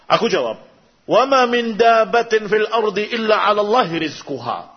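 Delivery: normal talking voice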